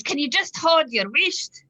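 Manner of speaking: slow, high Scottish telling-off; loud, pure Scottish. Retold or imitated. Annoyed mother to her noisy boys, loud pure Scottish scolding. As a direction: re-enact an exasperated outburst in broad Scots, loud and drawn out. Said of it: "It came out really loud and, like, pure Scottish".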